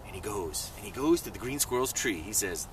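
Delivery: ordinary delivery, nothing marked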